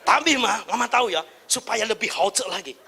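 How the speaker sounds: soft, low sweet-talking; gentle to wife. Pretend. Husband gently praising wife's cooking, soft and low. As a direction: coax her kindly. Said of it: "Bilang sama istri begini".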